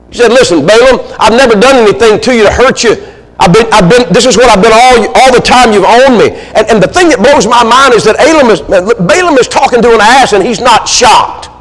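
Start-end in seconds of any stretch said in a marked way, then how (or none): 0.3-6.3 higher-pitched, acted indignant appeal; earnest protest. Mistreated donkey appealing to owner Balaam, earnest protest. 6.5-11.5 high, fervent amazement; incredulous preaching. Real, not acted. Preacher amazed Balaam chats with an ass, incredulous.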